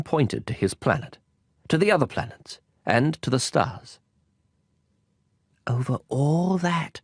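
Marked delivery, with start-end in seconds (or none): 5.7-7.0 a slow, higher, wondering question; little prince questions the king's reign; amazed question. Acted. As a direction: ask in disbelief. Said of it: "asked the little prince".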